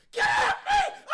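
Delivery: very high, full-volume shriek; screeching mock outburst; imitated scream. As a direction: act out a panicked public scream. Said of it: "Bro, he screamed".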